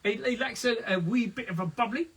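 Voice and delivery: high, hammed-up Dutch accent; bad Dutch accent. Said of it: "That's a terrible Dutch accent".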